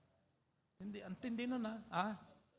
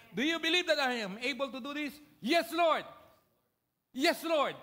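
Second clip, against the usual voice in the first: high, loud reenacted exchange; preaching call and response; 8 semitones higher, 10 dB louder than usual. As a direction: proclaim it up to the rafters. Preacher reenacts Jesus and blind men.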